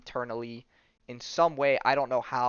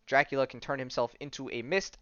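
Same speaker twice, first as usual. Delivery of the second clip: ordinary talk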